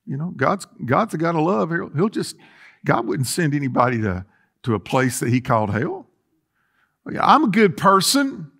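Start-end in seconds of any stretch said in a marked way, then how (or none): none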